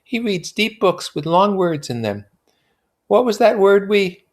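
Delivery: put-on high, airy young woman; lilting society girl; high voiced Daisy; Daisy's voice. A narrator's reading. Daisy voiced in read novel.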